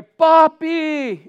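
high, loud, drawn-out call to Dad; child's cry for help. Retold or imitated. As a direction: cry out like a child falling into water. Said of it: "he cried out to me".

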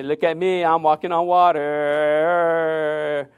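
slow, drawn-out mock boast; boastful believer. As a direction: show off, stretching the words.

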